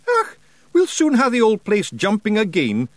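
high cheeky Scottish brogue; grinning Scottish Feegle. A narrator's reading. Rob teases the ferryman, high grinning Scottish brogue.

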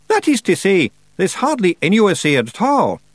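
high, loud Scots pixie squeak; little blue man blurting cover story; Feagle voice. Acted. Feagle under bed.

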